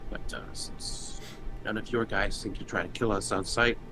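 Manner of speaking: ordinary delivery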